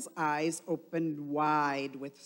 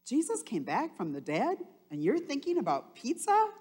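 put-on higher, louder scolding girl, fast; cartoon sister; 8 semitones higher, 4 dB louder, faster than usual. Cartoon sister teasing Otto, high and incredulous. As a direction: scold her brother with disbelief. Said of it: "his sister says".

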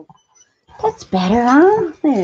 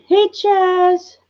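pet talk; 8 semitones higher than usual. Real, not acted. Talking sweetly to pet chipmunk.

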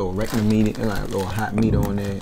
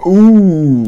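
loud ooh; 10 semitones higher, 18 dB louder than usual. Real, not acted. Reacts loudly before a burp.

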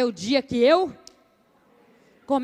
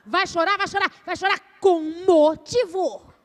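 threatening mother; 4 semitones higher, 3 dB louder than usual. Shown as a demonstration. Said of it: "a mãe silábica".

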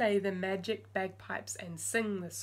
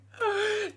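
gasp; 12 semitones higher, 5 dB louder than usual. Acted. Surprise in story.

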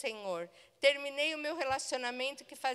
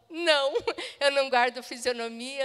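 laughing apology; 7 dB louder than usual. Retold or imitated. Embarrassed laughing admission.

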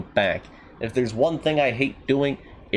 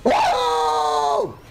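startled whoop. Real, not acted. Player sets off the train, high whoop. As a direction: let out a piercing whoop.

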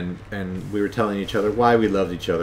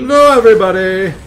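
loud booming alien greeting; Zero the Hutt voice; 10 semitones higher, 10 dB louder than usual. Retold or imitated. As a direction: boom out a big hello. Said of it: "sound like Zero the Hutt".

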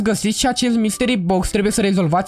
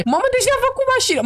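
much higher, fast amazed exclamation; amazed at the surprise car, higher and fast; surprised excitement; faster than usual. Acted. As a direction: gasp it out, thrilled and astonished.

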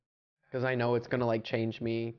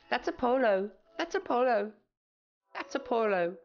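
high-pitched mocking British accent; 9 semitones higher than usual. Streamer mimicking chat about Lifesavers, high mock British accent. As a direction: squeak it like a pestering British chatter. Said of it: "I can't hear you over your British accent".

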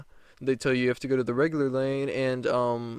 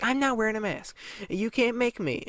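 much higher, childish mimic of a stubborn woman; mocking tantrum voice; bratty Karen; 10 semitones higher than usual. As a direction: taunt like a spoiled kid. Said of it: "And she's like".

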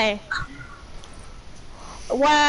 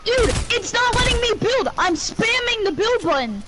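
raging gamer; 8 semitones higher than usual. Real, not acted. Can't build while being shot.

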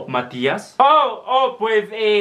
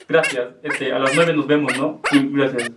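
low voice; 6 semitones lower than usual. Acted. The other character answers.

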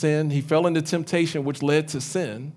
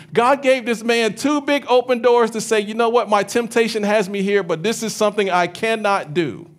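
high, loud, driving sermon delivery; fervent preaching; 7 semitones higher, 5 dB louder than usual. Acted. Pastor preaching hard to the church, fervent and loud. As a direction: preach it out to the congregation.

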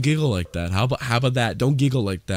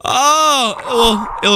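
startled cry; 8 semitones higher, much slower than usual. Real, not acted. Startled by game scene.

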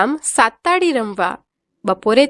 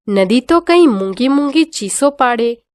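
plain reading voice

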